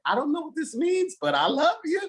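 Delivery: high, tender grandmother voice, a touch teary; grandmother's loving reply. Retold or imitated. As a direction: play the crying grandma who accepts him anyway. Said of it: "she was crying".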